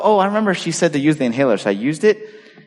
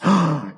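a short, hammed-up shocked gasp; mock gasp. Patient hearing he should borrow his son's inhaler, mock gasp. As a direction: pretend to be scandalized.

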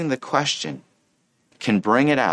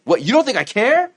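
high, incredulous comeback; acted defensive outrage; indignant retort; 10 semitones higher, 3 dB louder than usual. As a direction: fire back offended, rising sharply at the end. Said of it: "my flesh wants to say".

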